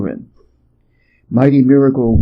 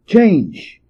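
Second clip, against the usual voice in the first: cried out; 6 semitones higher than usual. A narrator's reading. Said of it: "and cried".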